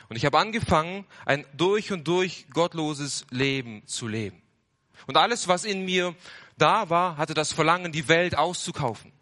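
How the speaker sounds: pushing the sinful craving loud and high; fervent preaching. Acted. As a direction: preach it with mounting passion. Testimony of worldly craving.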